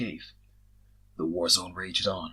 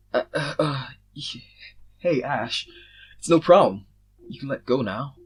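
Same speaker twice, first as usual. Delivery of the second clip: awkward; 5 semitones higher, 8 dB louder than usual. A narrator's reading. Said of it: "with an awkward smile".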